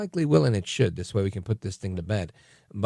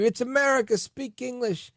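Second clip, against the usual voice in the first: mock yell; 13 semitones higher than usual. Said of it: "when people would scream".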